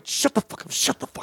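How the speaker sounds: hissed under the breath; a stressed rider whispers at a worried man; whispered snap. Retold or imitated. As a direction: hiss it through your teeth, barely voiced.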